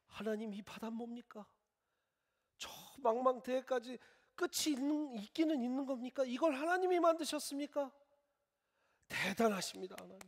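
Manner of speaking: rising, loud wonder at the sea; awed praise. Pretend. Adam praising God for the vast sea, in loud awe. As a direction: gush at God like a thrilled Adam.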